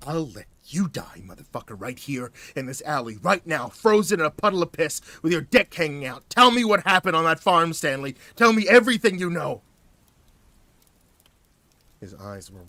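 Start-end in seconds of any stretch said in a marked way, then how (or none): 0.1-9.6 hissed threat. A narrator's reading. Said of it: "I hissed".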